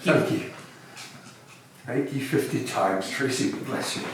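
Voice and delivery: touched thanks. Acted. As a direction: thank him quietly, deeply moved. Scrooge moved, thanking softly.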